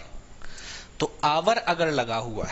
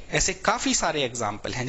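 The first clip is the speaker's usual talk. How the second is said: usual speaking voice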